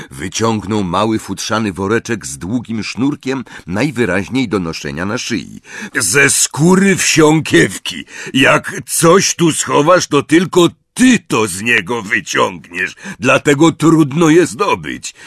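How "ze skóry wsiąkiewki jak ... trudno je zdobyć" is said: a big friendly giant reminiscing; Hagrid's voice. A narrator's reading. Hagrid to Harry, fondly remembering.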